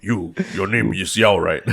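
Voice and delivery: lightly imitated gravelly voice, slow; a hint of a gruff older man, drawn out. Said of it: "Harish, he has this gravelly voice".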